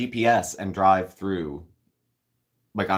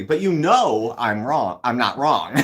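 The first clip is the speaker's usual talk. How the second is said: teasing; 5 semitones higher, 7 dB louder, slower than usual. Real, not acted. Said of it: "teasing a viewer".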